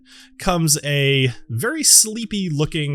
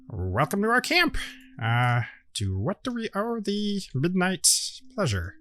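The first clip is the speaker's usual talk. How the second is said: softer, lightly higher gnome welcome; a small host greeting strangers at night; 5 semitones higher, 6 dB quieter than usual. Acted. Gnome welcomes the party to camp, softly lifted.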